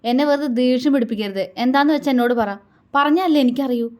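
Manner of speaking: losing patience, voice rising. A narrator's reading. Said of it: "അവൻ്റെ ശബ്ദം ഉയർന്നു പോയിരുന്നു". (ശബ്ദമുയർത്തി)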